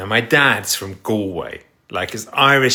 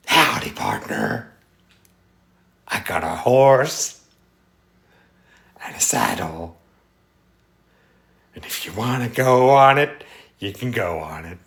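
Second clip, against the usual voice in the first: put-on Texan accent. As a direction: take your time, hammed-up Texan rancher. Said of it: "I like Texas".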